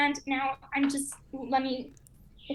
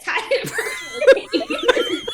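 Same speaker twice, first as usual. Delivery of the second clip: laughing; 9 dB louder than usual. Real, not acted. Laughing accusation of a friend.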